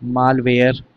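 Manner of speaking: "मालवेयर" said alone as a careful model; model pronunciation. Shown as a demonstration. Teacher modelling a new word for students, careful and clear. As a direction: say "मालवेयर" clearly as a demo for the class. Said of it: "ऐसे ही होता है मालवेयर".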